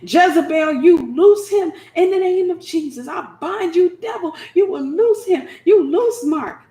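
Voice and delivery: loud, high commanding prayer; loud high spiritual warfare shout; fierce rebuke. Retold or imitated. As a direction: bind the devil at full force.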